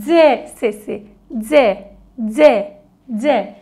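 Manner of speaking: C sounded slowly letter by letter; teacher drilling the sound "C" for children. Shown as a demonstration. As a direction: say "C" as a drawn-out dzh sound, repeated clearly. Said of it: "iki farklı ses var".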